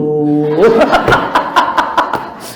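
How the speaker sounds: chuckling at her own story; laughing. Real, not acted. Preacher laughing as she tells of the phone call. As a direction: laughs along with the crowd.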